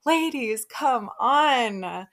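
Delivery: exasperated, drawn-out plea; worked up. Real, not acted. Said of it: "I love getting all worked up".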